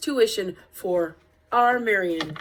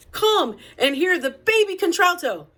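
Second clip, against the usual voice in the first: announcer call; 8 semitones higher, 5 dB louder than usual. Acted. Reading the church advertisement aloud.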